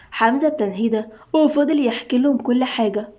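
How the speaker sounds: ordinary delivery, nothing marked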